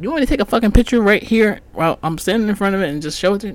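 fast, exasperated retort; exasperated rant. Retold or imitated. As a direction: fire it back at him, fed up and quick. Arguing with the clerk.

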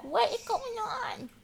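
high, soft, giggly dog voice; voicing the dog. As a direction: play a bewildered pet in a tiny voice. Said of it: "look at Andy. He's like".

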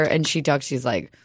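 normal talking voice

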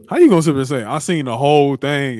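high, loud incredulous challenge; indignant outburst. Real, not acted. Host calling out someone who took it all back, loudly indignant. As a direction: call them out, voice shooting up loud.

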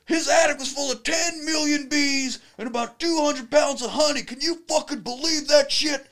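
high, laughing Southern announcement; laughing impression of a Southern actor; announcing to everyone. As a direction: broadcast it to the whole café.

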